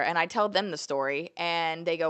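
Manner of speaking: ordinary talk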